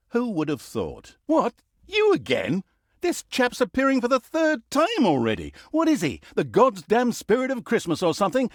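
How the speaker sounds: annoyed narrator ranting at a recurring nuisance; exasperated rant. Acted. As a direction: lose patience with the pest. Narrator fed up with Tanos.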